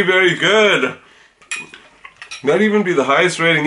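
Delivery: speaking between spoonfuls; talking while chewing. Real, not acted. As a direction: mouth partly full of cereal. Seen 2: Both eat cereal between lines; mouths work food while speaking.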